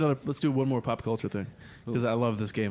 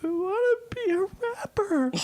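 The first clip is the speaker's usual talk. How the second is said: very high sobbing wail; hammed-up sob; mock crying. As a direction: imitate a crying girl. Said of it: "crying her eyes out".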